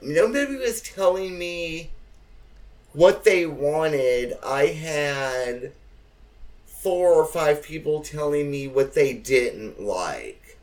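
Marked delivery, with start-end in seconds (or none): none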